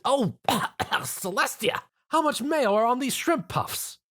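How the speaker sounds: high, outraged cough and cry; indignant outcry. A narrator's reading. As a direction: burst out in offended shock. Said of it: "the fashionista cried".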